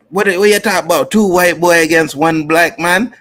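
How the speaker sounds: hammed-up Jamaican gangster voice from the movie; Jamaican movie villain. Quoting Screwface from Marked for Death.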